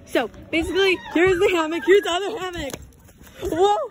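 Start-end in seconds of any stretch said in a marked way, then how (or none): 3.4-3.8 startled high whoa; surprised whoa. Real, not acted. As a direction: yelp as the hammock swings. Nearly falling off hammock.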